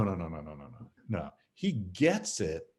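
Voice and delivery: ordinary delivery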